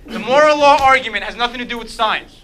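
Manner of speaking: ordinary delivery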